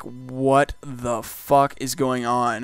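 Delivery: drawn-out, word-by-word disbelief; incredulous. Retold or imitated. Night-shift worker reacting to chaos, incredulous. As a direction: stretch each word of "what the fuck", stunned.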